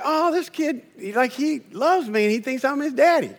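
high, soft, laughing delight; tickled the boy thinks he's your daddy; amused delight. Retold or imitated. Pastor charmed by boy grabbing his leg, high laughing. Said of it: "I was like".

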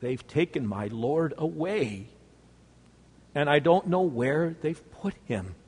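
barely holding back tears; quiet broken answer; quivering voice. Acted. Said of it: "with a quivering voice".

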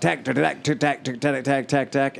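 steady clacking engine noise; sputtering engine imitation. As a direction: tap out "tack" like a misfiring motor.

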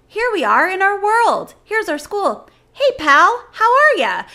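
high, loud cheery sitcom lines; cheesy sitcom voice. Retold or imitated. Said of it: "it's like".

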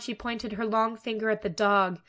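ordinary delivery

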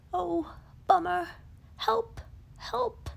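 a stuck bear calling for rescue; crying out for help. Acted. Pooh covered in leaves calls out in hammed-up alarm. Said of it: "Pooh cried out".

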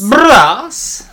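"Brass" with a lip trill for the r, high and loud; rolled r with the lips. Shown as a demonstration. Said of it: "rolling your R's with your lips".

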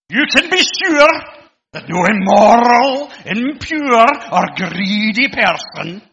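loud, threatening broad Scottish King James reading; broad Scottish accent. Pretend. Preacher mocking a threatening broad Scottish accent. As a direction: boom it out in broad Scottish, slow and menacing. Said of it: "read this in the old, in the King James in a really broad Scottish accent".

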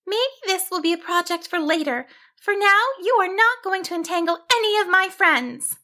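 high, resolute sheep voice; high-pitched, firm self-talk; determined sheep heroine. Acted. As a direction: tell it off in a high little voice. Sheep heroine addresses the net.

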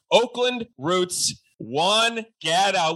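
slow, drawn-out name announcement; slow hype guest introduction; announcer build-up. Acted. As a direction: draw the names out like a stadium announcer.

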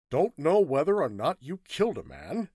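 incredulous reporter, pitch swinging high. Acted. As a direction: ask it as if you can't believe it. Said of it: "asked Hatch incredulously".

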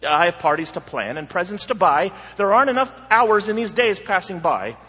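loud, fast, hurried busy man; frantic busy man. Acted. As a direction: rattle it off like an overbooked man.

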